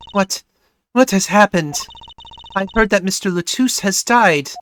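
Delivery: hint of a European accent; vague European accent. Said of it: "vaguely European accent".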